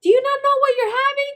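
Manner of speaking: high, fast incredulous nurse. Retold or imitated. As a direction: mimic a surprised nurse. Nurse questions the mother.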